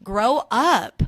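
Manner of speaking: loud scornful grow up; telling the rude driver to grow up; scolding call. Acted. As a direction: slap it down like a catchphrase. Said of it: "you're a fucking titty bitch loser".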